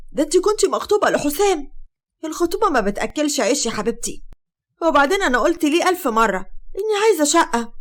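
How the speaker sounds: high, loud and brazen; indignant young woman. Acted. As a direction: raise the pitch and toss the words back. Sheimaa defends marrying the uncle.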